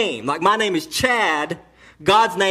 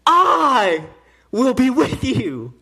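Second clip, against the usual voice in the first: forceful declaration; 4 semitones higher, 4 dB louder, slower than usual. Acted. Preacher's climax, voicing God.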